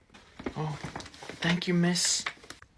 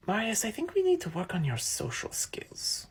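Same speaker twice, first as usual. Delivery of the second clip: whisper; 4 semitones higher, 11 dB quieter than usual. Acted. Teasing aside.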